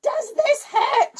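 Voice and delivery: high-pitched nurse question; nurse asking. A narrator's reading. Nurse poking the boy's head, high. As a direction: ask it high and sweet. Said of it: "she said".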